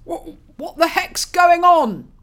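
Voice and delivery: loud, high, slow stammering shock; faltering. Acted. Father bursting into the stable, shocked and faltering. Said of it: "Roland faltered".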